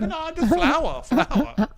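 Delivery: high, slow broken-English excuse; pretending nothing happened; old lady, fake innocent. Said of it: "She's like".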